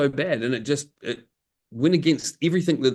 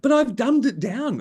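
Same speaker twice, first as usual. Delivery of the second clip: mock protest; 9 semitones higher than usual. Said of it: "They just were like".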